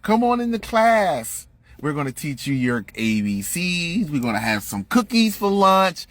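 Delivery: hammed-up syrupy kindergarten teacher; mock sweet teacher. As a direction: coax a small child into class, fake-nice. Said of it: "this is how they fucking talk".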